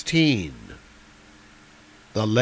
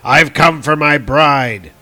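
mildly blunt dwarf, louder and higher; raised-voice, matter-of-fact demand; 4 semitones higher, 9 dB louder than usual. A narrator's reading. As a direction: state the claim bluntly and loudly. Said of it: "the dwarf said bluntly".

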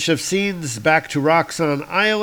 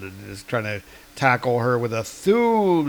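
ordinary delivery

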